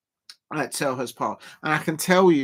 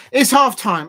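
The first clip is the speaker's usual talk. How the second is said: higher, louder call that it's half time; excited announcement; 8 semitones higher, 8 dB louder than usual. Real, not acted. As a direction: announce the break with a burst of energy.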